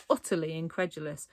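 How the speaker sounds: ordinary delivery, nothing marked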